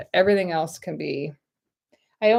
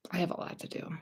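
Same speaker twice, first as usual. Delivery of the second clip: whisper; 14 dB quieter, faster than usual. Real, not acted.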